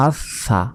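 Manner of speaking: আস সা with the s sounded twice; doubled consonant. Shown as a demonstration. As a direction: close on as, then release sa in আস সা. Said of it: "সা নিজে একবার উচ্চারণ হবে". (আস সা, স দুইবার)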